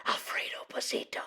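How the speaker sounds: hushed, breathy whisper of the crowd. A narrator's reading. The frightened crowd whispers the bandit's name. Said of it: "whispered the crowd".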